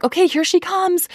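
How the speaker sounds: high, lilting heads-up, lightly acted; retold excited announcement. Doctors telling mother the baby is coming, retold excited announcement.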